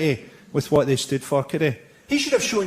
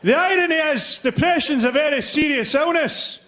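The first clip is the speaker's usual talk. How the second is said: indignant heckler; 8 semitones higher than usual. Retold or imitated. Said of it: "he went".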